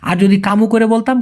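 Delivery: ordinary talk, nothing marked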